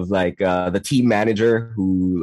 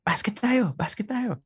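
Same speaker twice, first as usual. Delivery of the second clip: friend's invite; 12 semitones higher, 6 dB quieter than usual. Retold or imitated. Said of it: "a friend of mine says".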